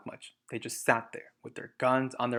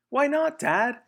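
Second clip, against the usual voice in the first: very high, loud little-fox cry; small fox crying out why; protesting child fox; 7 dB louder, slower than usual. Acted. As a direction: protest to Dad in disbelief.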